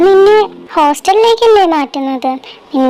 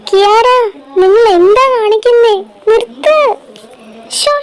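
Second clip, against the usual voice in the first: frantic scolding shout; 5 semitones higher than usual. Acted. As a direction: yell to break up a fight. Mother breaks up kids' fight.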